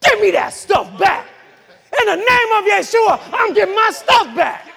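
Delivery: loud, high preacher's shout; fierce shouted command; violent demand. Acted. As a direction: demand it back forcefully, punching each word. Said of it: "you gotta be violent with this thing".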